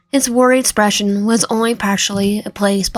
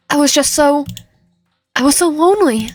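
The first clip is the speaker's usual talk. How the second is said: crying; 5 semitones higher than usual. Acted. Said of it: "He started crying".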